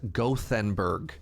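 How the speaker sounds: ordinary talk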